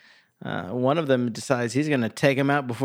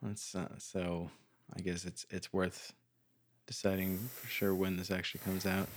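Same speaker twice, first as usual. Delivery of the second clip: quiet; 5 semitones lower, 15 dB quieter than usual. Real, not acted. Drops to near mumble.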